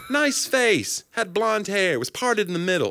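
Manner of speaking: high-pitched character recall; New York bartender. Acted. Bartender describing a customer's face, mock-deliberate. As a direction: list details in a put-on high voice. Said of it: "the New York bartender who remembers".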